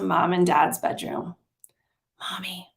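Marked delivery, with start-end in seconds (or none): 2.2-2.7 breathless whispered call in the dark. Acted. Child whispering to mom at night, hushed whisper. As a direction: whisper it so you don't wake anyone. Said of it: "I whispered".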